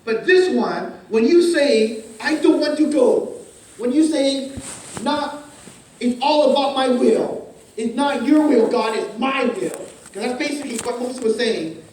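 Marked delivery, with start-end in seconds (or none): 2.2-3.4 loud, higher-pitched dug-in refusal; defiant refusal. Acted. Moses telling God he won't go, defiant. 5.0-9.7 loud, emphatic self-will; defiant insistence. Acted. Moses setting his will against God's, defiant.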